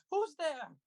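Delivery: sudden high, loud call into the dark; frightened cry. Acted. Old man woken in the dark, crying out in fright. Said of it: "crying out".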